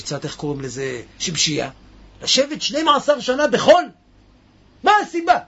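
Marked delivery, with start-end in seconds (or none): 2.2-5.4 rising, higher disbelieving question; incredulous. Acted. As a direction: sound amazed anyone would sit in sand. Teacher asks in disbelief.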